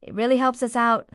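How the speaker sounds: ordinary delivery, nothing marked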